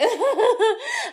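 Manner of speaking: high, laughing giggle done as her. Retold or imitated. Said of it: "I can only laugh like her".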